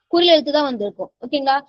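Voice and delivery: normal talking voice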